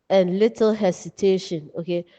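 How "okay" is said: ordinary talk, nothing marked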